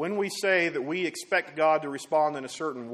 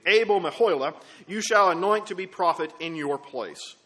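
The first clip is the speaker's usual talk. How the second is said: loud, raised proclamation; forceful pulpit reading, pitched up; commanding scripture reading; 4 semitones higher, 8 dB louder than usual. Acted. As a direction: declaim it as a divine command. Reads God's reply from scripture.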